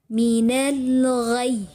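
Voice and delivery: "Minal ghai" drawn out very slowly, pressed on "ghai"; nabar demo. Shown as a demonstration. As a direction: recite "Minal ghai" slowly, leaning on the final "ghai" without breaking it. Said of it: "Atau dinabarkan ya". ("Minal ghai" dengan nabar, pelan dan ditekan di "ghai")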